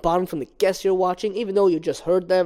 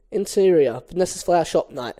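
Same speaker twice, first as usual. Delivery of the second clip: ordinary talk, nothing marked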